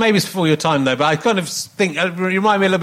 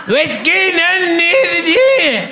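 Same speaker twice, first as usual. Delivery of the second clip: hammed-up Glaswegian, very high and drawn out; stretched, high-pitched mock Glaswegian; Glasgow accent; 4 dB louder, much slower than usual. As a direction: yell a cartoonish Glasgow accent. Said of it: "you were in Glasgow".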